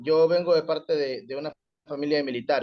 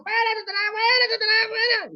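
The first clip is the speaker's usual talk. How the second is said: shrill, much higher mock yelling of an exorcist; frantic shouting. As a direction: screech it out like a panicked exorcist. (grito frenético muy agudo de exorcista)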